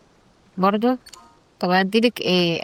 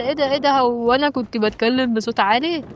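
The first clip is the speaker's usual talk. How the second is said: imitating villager; 5 semitones higher than usual. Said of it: "بعد كده قال لي".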